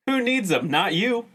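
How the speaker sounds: ordinary talk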